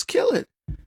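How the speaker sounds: casual SWAT taunting the hostage taker; mock careless dare. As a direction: shrug it off and dare him. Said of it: "swat says".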